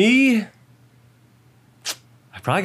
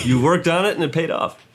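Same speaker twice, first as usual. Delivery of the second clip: ordinary delivery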